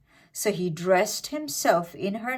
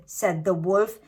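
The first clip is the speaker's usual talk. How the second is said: usual speaking voice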